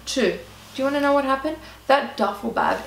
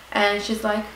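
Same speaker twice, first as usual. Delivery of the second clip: usual speaking voice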